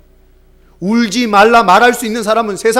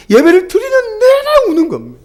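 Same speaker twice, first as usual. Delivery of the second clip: voice breaking; 5 dB louder than usual. Real, not acted. Recalling crying child.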